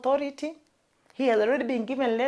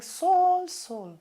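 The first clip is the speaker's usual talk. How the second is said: calling Saul; 7 semitones higher than usual. Acted. Voice of Jesus calls Saul.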